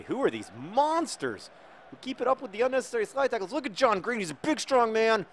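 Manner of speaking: high-pitched mock alarm; frightened opponents. Scared opponents marvel at the slide tackles, mock alarm. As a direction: exclaim it high, in pretend dread. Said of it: "Because they say".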